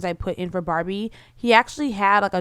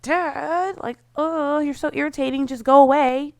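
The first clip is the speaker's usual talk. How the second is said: put-on annoyed teen; 6 semitones higher than usual. Barbie snapping at dad.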